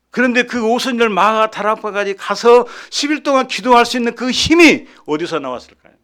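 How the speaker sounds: loud, high, driving sermon voice; fervent preaching. Acted. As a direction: preach it hard to the congregation. Preaching at full force.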